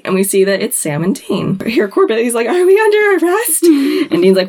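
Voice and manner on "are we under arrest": high nervous question. Retold or imitated. Corbett facing the police.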